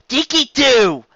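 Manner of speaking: loud, high call to get her attention; teacher raising her voice at a daydreaming filly. Acted. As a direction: snap a distracted pupil back. Teacher calls the distracted student.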